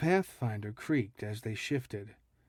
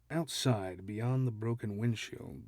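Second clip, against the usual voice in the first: usual speaking voice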